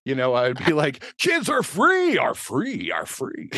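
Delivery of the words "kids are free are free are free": self-made echo. Retold or imitated. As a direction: fake the echo by voice, dying away. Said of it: "I was doing the echo of the voice".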